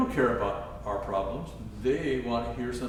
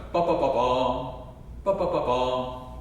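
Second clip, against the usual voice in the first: ba ba ba bum with a weighted final bum, second time slower; emphatic arrival; 4 dB louder, slower than usual. Shown as a demonstration. Teacher reminds student of the emphatic scale ending. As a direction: lean into the last note. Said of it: "Remember, we worked on".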